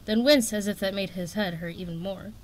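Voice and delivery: ordinary delivery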